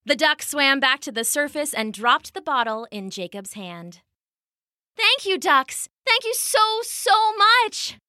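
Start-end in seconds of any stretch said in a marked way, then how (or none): 5.0-8.0 high, gushing thanks; overjoyed thanks. Acted. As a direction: burst with gratitude. Jacob thanks the ducks.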